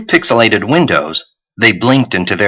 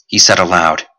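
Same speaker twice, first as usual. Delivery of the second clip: ordinary delivery, nothing marked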